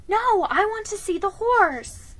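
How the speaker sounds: put-on high, loud, insistent young girl; defiant girl. A narrator's reading. Felicity refusing Ben's order, defiant and high.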